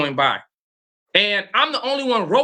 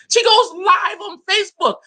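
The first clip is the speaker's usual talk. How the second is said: incredulous shout; 12 semitones higher than usual. Real, not acted. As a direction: shout it in disbelief. Exclaiming disbelief at wife.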